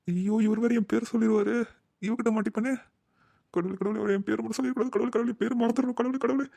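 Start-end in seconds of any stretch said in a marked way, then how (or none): 3.5-6.5 pleading under the breath, rising higher with each plea; frantic prayer. Acted. Hiding brother prays that the father won't say his name, a panicked mutter. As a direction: beg God faster and higher each time.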